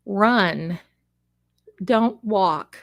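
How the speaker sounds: ordinary delivery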